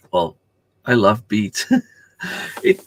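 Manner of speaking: ordinary talk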